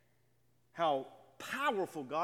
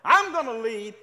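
louder with each claim, hammed-up bragging; boastful conqueror; 4 semitones higher, 10 dB louder than usual. Preacher mocking would-be leaders of mankind: mock boastful bragging, growing louder. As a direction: build the brag louder line by line. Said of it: "people who have said".

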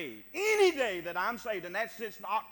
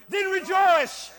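high, loud pulpit shout; commanding shout; 9 semitones higher, 12 dB louder, slower than usual. Acted. Preacher voicing God's command to rejoice, commanding shout. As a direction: fire it out as a drawn-out command.

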